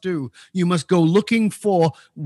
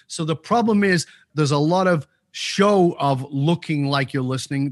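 ordinary delivery

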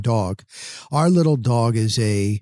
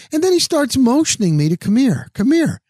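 indignant; 10 semitones higher than usual. Real, not acted. Man waving him over rudely.

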